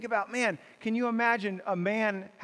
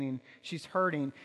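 usual speaking voice

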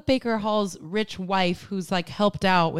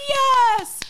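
high, loud stagey cheer; cheering yes; 5 dB louder than usual. Retold or imitated. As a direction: shout it like a thrilled actor. Actors cheer in retelling.

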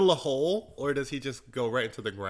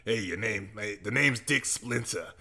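detective voice; 6 semitones lower than usual. Acted. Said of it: "Dick Splinter is my detective name".

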